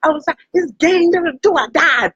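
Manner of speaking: high, mock little-kid threat; toddler threat. Retelling a viral toddler video, high mock threat. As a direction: play the tiny tough kid, high. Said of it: "He was like".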